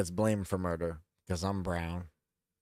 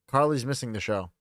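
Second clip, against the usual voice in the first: ordinary talk, nothing marked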